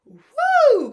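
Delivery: very high, loud whoop; celebratory woo. Real, not acted. Vlogger reliving the game-winner with a loud whoop. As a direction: let out a big cheer.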